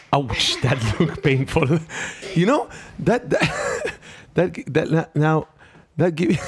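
laughing. Real, not acted. Laughing at painful stretch.

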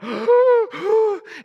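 loud exaggerated panting; acted winded gasps; huffing and puffing. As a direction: huff and puff like an exhausted player. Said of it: "he's just huffing and puffing".

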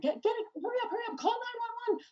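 high, frantic panicked urging; freaking out. Retold or imitated. Mother retelling an emergency during her son's seizure, frantic. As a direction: urge them on in mock panic. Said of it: "I'm, like, freaking out".

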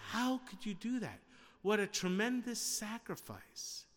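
high-pitched retold protest; mild mock dismay. Family objecting to the bride's move, mildly appalled.

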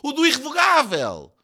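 high, stammering panic; panicked. A narrator's reading. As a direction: grope loudly for the name. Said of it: "Em pânico, Soares lá se lembra".

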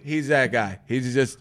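ordinary talk, nothing marked

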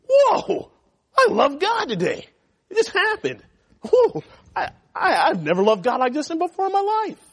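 cartoonish sudden-revelation voice with a laugh; mock amazement. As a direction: play a wide-eyed convert, overdone. Mocks a sudden emotional awakening.

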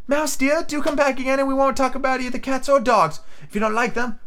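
very high, sing-song coaxing; high-pitched pleading little girl; soft calling Alice. A narrator's reading. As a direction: coax the mouse back in falsetto. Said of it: "So she called softly after it again".

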